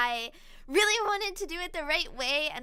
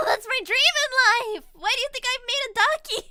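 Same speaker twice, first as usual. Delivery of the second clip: excited gushing; 10 semitones higher, 11 dB louder than usual. Real, not acted. Gushing about anime looks.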